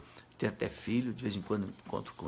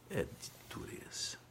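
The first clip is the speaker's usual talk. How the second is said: slow, much softer whisper; moved whisper; 2 semitones higher, 12 dB quieter than usual. Real, not acted. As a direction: breathe it out, heavy-hearted. Preacher moved by the story. (sussurro comovido)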